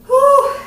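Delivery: sharp high whoosh of breath; loud winded whew; relieved exhale. Real, not acted. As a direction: blow out hard after the burpees. Breather after a timed set.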